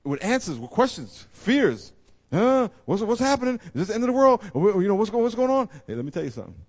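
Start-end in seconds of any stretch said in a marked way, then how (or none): 2.3-5.7 mock frightened churchgoer. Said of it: "people coming in with fears".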